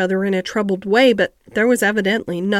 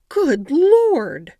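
higher, more alarmed Good Lord; higher startled exclamation; 7 semitones higher than usual. A narrator's reading. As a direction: pitch it up in fresh shock. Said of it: "exclaimed poor Missus Medlock".

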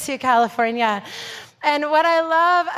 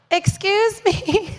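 high, slow, pointed excuse me; insistent call-out; 7 semitones higher, slower than usual. Retold or imitated. Preacher calls high and slow again to an agent who looked away. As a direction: refuse to be ignored, draw it out. Calling again after being ignored.

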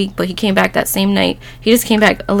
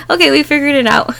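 higher, quick line between laughs; laughing at your own eager claim; amused self-quote; 6 semitones higher, faster than usual. Real, not acted. Recalling asking again a month in, amused.

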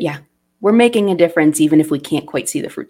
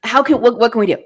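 lightly voiced teenage boy, a touch higher; a hint of a sulky student; mimicked student; 4 semitones higher, faster than usual. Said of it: "this young man said was".

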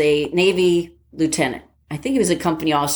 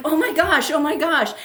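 higher, shocked oh my gosh; 11 semitones higher than usual. Retold or imitated. Teammates seeing the runner trip, shocked and high. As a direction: gasp it out in disbelief. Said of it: "we're like".

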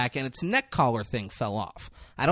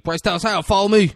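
louder, higher mock command; hero calling out; 7 semitones higher, 5 dB louder, faster than usual. Chris Pratt on a dirt bike calling to her, raised voice. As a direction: call back over the engine.

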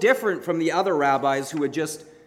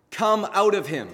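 commanding; 4 semitones higher, 4 dB louder than usual. A narrator's reading. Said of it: "with complete authority, he commanded".

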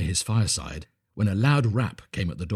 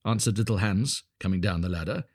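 ordinary delivery

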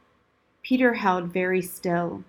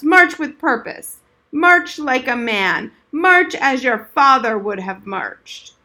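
louder, slow stern orders; drill commands; 3 semitones higher, 6 dB louder than usual. Acted. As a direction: drill him like a sergeant. Old soldier drills Peter.

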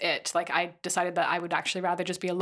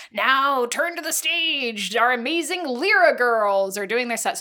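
slow, higher, louder club announcer call; 4 semitones higher, 5 dB louder, slower than usual. Retold or imitated. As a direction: boom it out like the MC on the club PA. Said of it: "there'd be this big announcement".

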